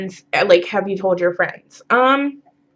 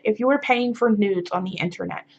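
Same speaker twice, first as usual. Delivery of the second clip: ordinary talk, nothing marked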